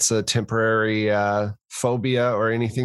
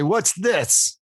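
pitched-up, pointed question; accusing demand; 8 semitones higher than usual. Acted. Player as investigator grabbing the suspect's arm, accusing demand. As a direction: demand an answer in a higher voice.

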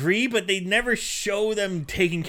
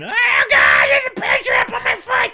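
very high, loud crying shout; hammed-up scream for help; mock panicked cry; 8 dB louder than usual. Imitating girlfriend caught in bear trap.

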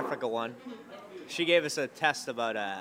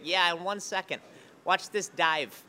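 higher-pitched friend impression; Tom Dustin; 6 semitones higher, 4 dB louder, slower than usual. Friend showing off by the pool, imitated higher voice. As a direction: imitate a friend announcing his stunt. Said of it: "I do Tom Dustin".

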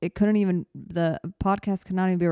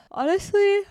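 word stretched through a real yawn, much higher; 10 semitones higher, slower than usual. Real, not acted. Tired podcaster to listeners, yawning. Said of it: "that yawn is appropriate".

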